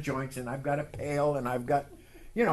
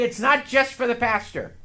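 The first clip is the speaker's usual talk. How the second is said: call and response; 6 semitones higher, 4 dB louder than usual. Shown as a demonstration. Preacher leads the congregation in repeating, calling out. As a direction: lead line for the church to repeat.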